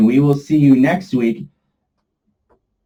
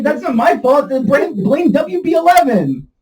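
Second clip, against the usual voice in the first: ordinary talk, nothing marked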